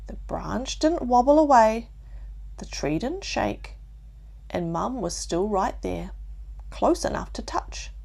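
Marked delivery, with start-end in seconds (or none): none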